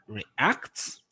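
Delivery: light RE, then lift ACT in react; prefix left unstressed. Shown as a demonstration. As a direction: react with rising, stressed ACT. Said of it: "stress a prefix".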